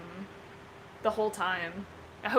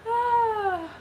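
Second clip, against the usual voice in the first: high delighted ah; 13 semitones higher, 5 dB louder than usual. Real, not acted. Delighted exclamation.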